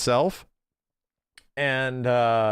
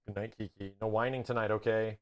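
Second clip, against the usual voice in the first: much softer, gentle goodnight to the puppy; tender goodnight; 4 semitones lower, 10 dB quieter than usual. Real, not acted. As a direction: tuck the dog in with a soft voice. Says goodnight to the dog.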